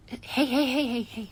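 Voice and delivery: urgent, loud hey's rising in alarm; alarmed calling out. Acted. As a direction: rush over to a friend who breaks down. Friend suddenly starts crying.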